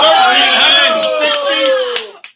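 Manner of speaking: slow, loud and high bid call; excited shout. Real, not acted. Auctioneer to the crowd after a big jump in bids, shouting. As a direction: belt out the new price. Bid announced over cheering.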